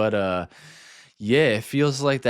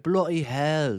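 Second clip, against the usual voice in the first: higher-pitched mock British accent; British impersonation; 5 semitones higher, slower than usual. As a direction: put on a silly American take on British. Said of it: "British impersonations".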